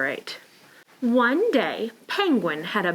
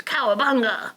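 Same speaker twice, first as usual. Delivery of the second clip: Little Lobster; 3 semitones higher than usual. Acted. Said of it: "Little Lobster says".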